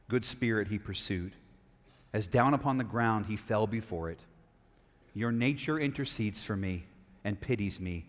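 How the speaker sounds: low, soft reading with long pauses; hushed story narration. A narrator's reading. Preacher reading the ghost softening to the plea, hushed narration. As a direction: read it gently, letting each phrase settle.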